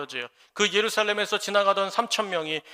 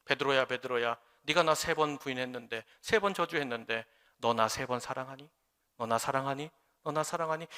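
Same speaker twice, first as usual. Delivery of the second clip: tender call; 5 semitones lower, 6 dB quieter than usual. Acted. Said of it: "그리고 뭐라고 말씀하세요?".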